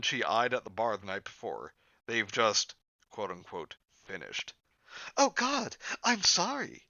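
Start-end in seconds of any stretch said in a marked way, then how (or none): 5.2-6.8 put-on very high-pitched girlish character voice; high, startled script-reading voice; flustered apology. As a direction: squeak out an embarrassed apology. Reads Blossom walking in, embarrassed.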